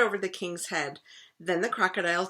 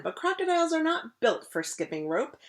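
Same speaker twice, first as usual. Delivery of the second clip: plain reading voice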